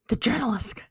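journalisk said with a hissing sk ending like basilisk. Pretend. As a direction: hiss the word journalisk like a serpent. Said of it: "say that like basilisk".

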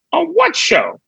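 high-pitched retold disbelief; incredulous. Actor questioning Raven's series regular offer, incredulous. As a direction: question the offer in mock bafflement.

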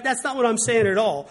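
mocking a touchy friend. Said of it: "imitating the misunderstood friend".

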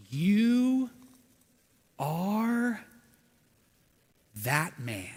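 soft, slow, pause between each word; gentle loving tone. Acted. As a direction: gently lay the truth down. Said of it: "very respectful, loving, gentle tone".

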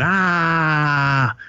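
short high mock scream, a quick "Ah!" thrown in mid-story; retold mock scream. Retelling the movie, the hooker screams, as a quick mock yell. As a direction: yelp it out like the screaming woman on the bed. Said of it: "still laying there screaming".

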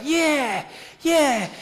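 high, loud mock cheering; excited whoop. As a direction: whoop it up like something going nuts. Acting out a toy going nuts.

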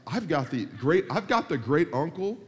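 ordinary talk

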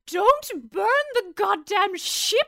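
high, slow, outraged plea; excited protest. Acted. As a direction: beg them not to burn it. Pirate protests the ship burning.

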